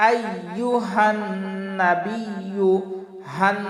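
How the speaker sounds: আইয়ুহান with a held nasal n; ghunnah. Shown as a demonstration. Teacher shows ghunnah, আইয়ুহান with held nasal n. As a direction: hum through the n of আইয়ুহান, slowly. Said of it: "এখানে একটা গুন্না হবে".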